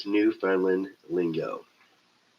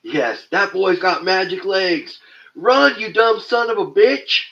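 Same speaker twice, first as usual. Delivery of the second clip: Walter Brennan impression; 9 semitones higher, 6 dB louder than usual. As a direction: cackle it out like a grizzled old coot. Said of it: "You do Stumpy Walter Brennan impressions".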